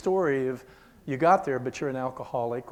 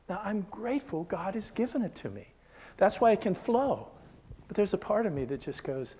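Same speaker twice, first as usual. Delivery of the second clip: ordinary talk, nothing marked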